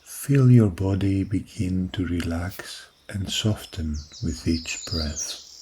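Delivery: usual speaking voice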